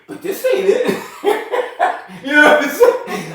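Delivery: high-pitched, cracking up mid-sentence; can barely get it out for laughing; words breaking into laughter. Real, not acted. Cracks up retelling an unwanted gift.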